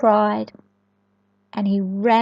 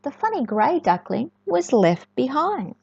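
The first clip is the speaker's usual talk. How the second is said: plain reading voice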